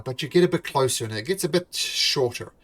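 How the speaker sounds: ordinary talk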